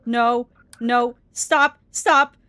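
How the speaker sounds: loud, desperate no and stop; scared pleading. Real, not acted. Begging the creepy figure to stop, loud and scared. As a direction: beg it to back off.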